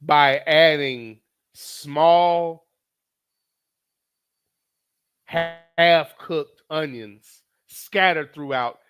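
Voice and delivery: drawn out slow and loud; mock tragic slow build; dramatic slow telling. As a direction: stretch each word in mock outrage. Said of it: "It's almost worthy of tears".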